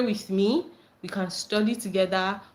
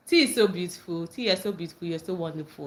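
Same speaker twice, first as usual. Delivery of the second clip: ordinary delivery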